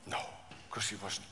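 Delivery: dropped to a whisper; sudden whispered reassurance; hushed answer. Real, not acted. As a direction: answer softly, almost secretly.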